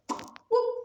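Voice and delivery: high, loud whoop for a flipping table; whoop sound effect. Acted. Preacher acting out the table flip, high loud whoop.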